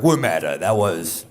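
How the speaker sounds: loud mocking Meta spokesman; smug loud company line; mock corporate voice. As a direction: ham up a corporate denial, loudly. Mocking Meta's corporate rebrand.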